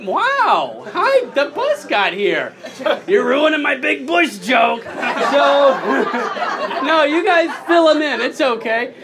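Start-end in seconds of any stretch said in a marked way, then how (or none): none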